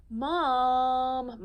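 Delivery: loud, high, drawn-out moan; moaning Mom.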